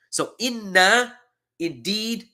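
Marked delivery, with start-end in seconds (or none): 0.1-1.2 inna lifted high and drawn out slowly; teacher stressing inna for emphasis; emphatic inna. Shown as a demonstration. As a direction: stretch the nn of inna for weight. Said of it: "an emphasis on".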